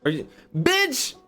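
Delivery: normal talking voice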